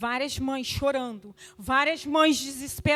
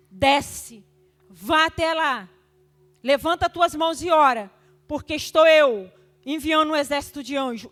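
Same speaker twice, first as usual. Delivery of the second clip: loud, urgent order to stop crying; commanding shout; 3 semitones higher, 9 dB louder than usual. Retold or imitated. Grandmother ordering crying mothers to stop and pray, loud commanding shout.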